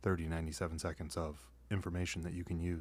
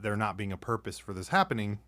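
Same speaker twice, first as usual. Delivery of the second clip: ordinary delivery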